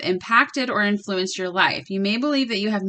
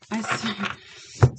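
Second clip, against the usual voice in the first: whispered; 6 dB quieter than usual. Real, not acted. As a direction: whisper the repeat under her breath. Apologizing after swearing on camera.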